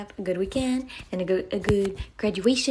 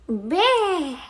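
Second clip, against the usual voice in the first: a short, high, louder cute "Burr" growl; playful mock growl; 8 semitones higher, 6 dB louder than usual. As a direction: growl like a little animal for the chat. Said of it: "Is that what you want?".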